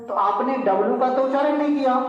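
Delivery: ordinary talk, nothing marked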